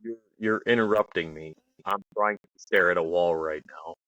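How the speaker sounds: low, deadpan gravity; most serious tone. Retold or imitated. Imitating the sergeant who was staring at a wall, most serious tone. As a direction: imitate him in dead earnest, voice dropped. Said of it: "with the most serious tone".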